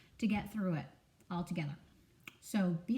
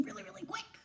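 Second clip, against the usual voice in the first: whispered quick; 10 semitones higher, 10 dB quieter, faster than usual. Shown as a demonstration. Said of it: "would you be walking".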